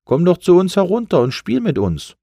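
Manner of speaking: friendly jellyfish. Acted. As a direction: coax the balloon down to play. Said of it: "sagte die Qualle". (hohe, einladende Quallenstimme)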